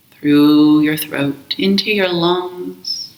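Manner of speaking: slow, calm meditation voice with long pauses; guided meditation. Acted. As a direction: guide them gently into the breath, unhurried. Leads a guided breath meditation.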